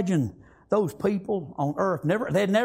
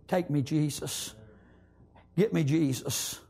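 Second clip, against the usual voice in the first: quiet, lower plea, a hint of the little boy; soft child's prayer; 5 semitones lower, 5 dB quieter than usual. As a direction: gently voice the boy's surrender. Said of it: "he said".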